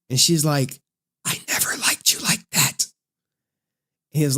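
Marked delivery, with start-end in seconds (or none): 1.2-2.9 breathy secret whisper. Retold or imitated. Real Nikki revealing herself in a whisper.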